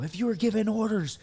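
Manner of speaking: high, loud mock taunting of a drunk senior; drunk senior Marine. Drunk senior Marine grilling a junior during push-ups, hammed-up high taunt. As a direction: needle the junior with a mocking singsong. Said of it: "they go".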